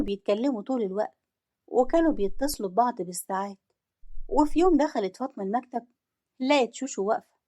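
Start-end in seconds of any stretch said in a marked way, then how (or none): none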